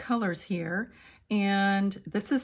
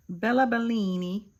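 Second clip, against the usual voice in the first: put-on Italian accent; 3 dB louder than usual. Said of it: "since I'm talking in an Italiana accent".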